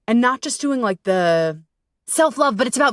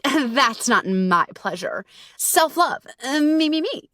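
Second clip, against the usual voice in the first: self-absorbed mockery; 5 semitones higher, slower than usual. Mocking self-obsessed trend.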